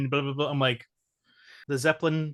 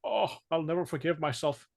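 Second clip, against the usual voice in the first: imitating Indy's dad; 2 semitones higher than usual. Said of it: "bashed on the head from his dad".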